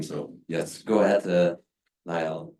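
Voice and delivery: normal talking voice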